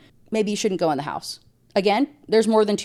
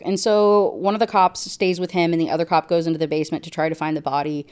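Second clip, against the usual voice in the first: ordinary talk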